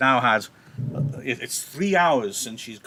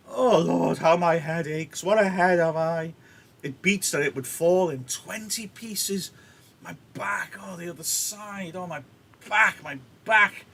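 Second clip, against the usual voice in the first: mock suffering nurse; 6 semitones higher than usual. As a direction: wail over every ache to stall. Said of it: "the nurse is winding her up".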